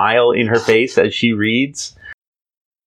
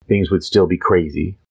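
usual speaking voice

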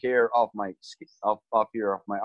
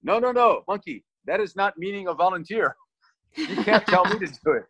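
wysoko, ze śmiechem udawany protest; naśladuje kolegę, protestując przez śmiech; laughing protest; 8 semitones higher than usual. Retold or imitated. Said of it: "And Zhao goes".

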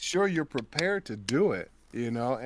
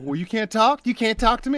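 higher, louder mock-offended questions; wife scolding silent husband, higher and louder; indignant wife; 8 semitones higher, 6 dB louder than usual. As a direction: play the wife put out he won't answer. Wife indignant he won't talk.

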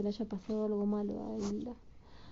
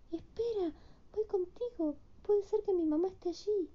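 put-on child Hilda voice; 8 semitones higher than usual.

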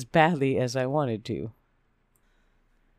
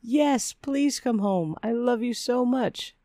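high, pleading gush; slow, high-pitched plea; strong-willed inner voice; 8 semitones higher, slower than usual. A narrator's reading. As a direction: beg with lovesick urgency. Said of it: "very loud and strong-willed part of me".

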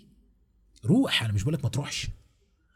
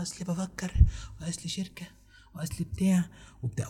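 mock hesitant mumbling; 4 semitones higher, 4 dB quieter than usual. Mocking indecisive excuses.

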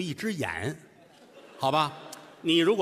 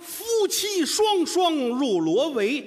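slow, high, drawn-out riddle chant; riddle recitation; 10 semitones higher, much slower than usual. Acted. Reciting the riddle line slowly and high to his partner. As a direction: intone each word slowly for the audience.

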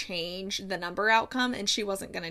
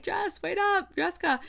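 calling after her; 9 semitones higher than usual. Retold or imitated. Calling after her as she runs.

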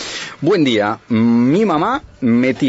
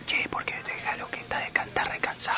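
soft unvoiced whisper; 13 dB quieter than usual. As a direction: whisper it like someone half asleep. Whispered quote while drowsing.

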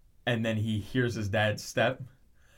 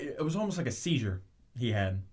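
usual speaking voice

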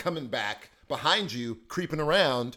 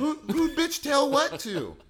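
put-on kid parroting; 12 semitones higher, 4 dB louder, slower than usual. Kid imitating mom.

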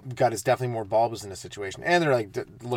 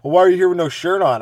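higher, louder cheeky retort; cheeky kid; 6 semitones higher, 9 dB louder than usual. Retold or imitated. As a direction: talk back with cheek. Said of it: "They're like".

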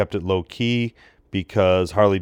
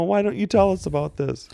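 much higher, outraged fan voice; indignant complaint; 10 semitones higher than usual. Retold or imitated. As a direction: protest like an angry young fan. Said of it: "I wanted to send it in to them and say".

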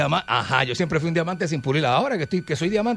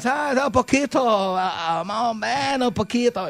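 put-on higher, very slow halting Spanish with an American accent; the American friend answering in broken Spanish, higher and halting; gringo Spanish; 6 semitones higher, slower than usual. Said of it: "él me decía".

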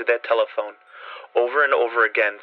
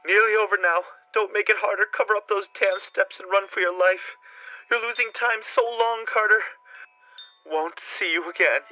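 faint resigned voice; 9 semitones higher than usual. A narrator's reading. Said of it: "the faint voice of Warren".

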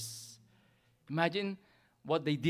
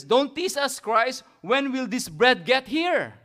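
louder, higher and slowed, begging to be fed; impatient crowd plea; 6 semitones higher, 6 dB louder, slower than usual. Retold or imitated. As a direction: whine like hungry people who can't wait. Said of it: "It's like they're saying".

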